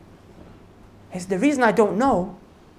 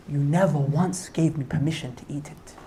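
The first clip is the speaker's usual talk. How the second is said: soft pious reply; 6 semitones lower, 6 dB quieter than usual. Retold or imitated. The pious gardener quietly tells his master why. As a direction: lower and softer, humble answer.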